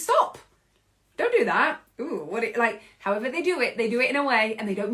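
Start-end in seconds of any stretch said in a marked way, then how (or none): 0.0-1.8 sharp high loud mock command; mock scolding. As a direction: snap it like a scolding parent. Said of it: "And the adult's like".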